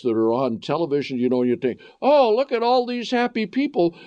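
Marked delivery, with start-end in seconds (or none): 2.0-4.0 high, sing-song sarcastic delight; sarcastic mock cheer, much higher. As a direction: gush with fake wonder. Mocking TV ads' cheer.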